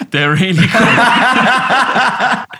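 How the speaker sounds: slow, loud, laughing emphasis; laughing drawl. Real, not acted. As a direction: stretch it out while laughing.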